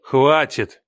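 mildly barked command. A narrator's reading. Man cuts off his ex-wife, a restrained bark. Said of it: "рявкнул я".

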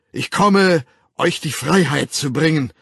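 loud, high proclamation; proclaiming. A narrator's reading. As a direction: announce liberation to a whole crowd. Savage proclaims freedom to the crowd. (laut verkündend)

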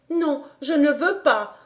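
put-on little girl declining the hat; girl stating no firmly; firm refusal. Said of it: "déclara Heidi".

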